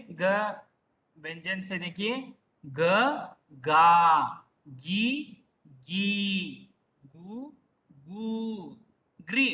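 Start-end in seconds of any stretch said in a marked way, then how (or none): 2.6-9.5 slow isolated syllables, long vowels stretched; syllable drill recitation. Shown as a demonstration. Teacher taking students through the ga row, measured recitation. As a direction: sound out the ga row, pausing after each. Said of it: "ग से देखेंगे".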